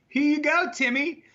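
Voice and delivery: high, bright mock-friendly call; cheery drive-thru voice; sweet customer voice. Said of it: "and you go".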